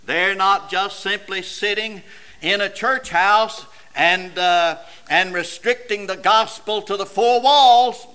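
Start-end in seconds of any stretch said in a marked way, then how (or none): none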